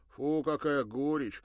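loud, wincing yuck; comic disgust. Acted. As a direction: grimace at the bitter taste. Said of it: "смешно поморщился".

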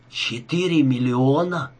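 slow, stunned question; forced out. A narrator's reading. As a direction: squeeze the words out slowly, as a question. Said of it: "выдавил из себя Рика". (выдавливая из себя, медленно)

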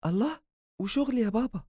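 high surprised son; happy surprise about the trip; delighted surprise. A narrator's reading. Son asking father about work, surprised.

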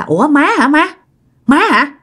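calling out nervously in the dark; startled plea; scared call. Retold or imitated. Frightened daughter calling mother.

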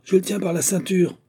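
high-pitched hail; called-out cry. A narrator's reading. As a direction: call to those on deck. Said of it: "cria le pêcheur".

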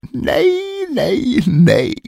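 a triumphant squeal of no; gleeful shrieking dwarf. Acted. Dwarf hops and shrieks at the queen's wrong guesses, squealing. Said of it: "hoppade och skrek".

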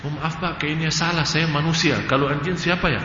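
softly spoken polite correction; retold meek apology. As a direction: answer the insult quietly and politely. Mild reply to a retold insult.

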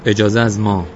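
loud soldier laying down authority; domineering assertion. A narrator's reading. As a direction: throw your weight around, loudly. Soldier asserts authority over boy.

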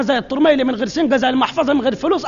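ordinary delivery

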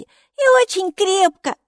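very high, slow, loud exclamation; amused delight. A narrator's reading. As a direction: draw it out gleefully in a high voice. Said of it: "засмеялась Майки".

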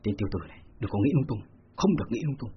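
low inner rebuke to himself; muttered self-scolding. A narrator's reading. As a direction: quietly tell yourself off. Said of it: "gã bất giác tự mắng".

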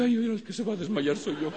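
high voice with a laugh; laughing joke. Retold or imitated. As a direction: joke you'll faint, laughing. Joking he'll faint. (broma aguda entre risas)

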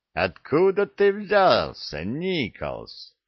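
slow, high question, falling away at the end; sergeant's demand. A narrator's reading. Master sergeant grilling a recruit, demanding. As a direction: stretch the question out imperiously.

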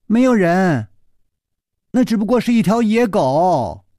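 nervous. A narrator's reading. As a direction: blurt out a flustered cover-up, pitched high. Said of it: "石秀云紧张地说". (紧张慌乱地高声否认)

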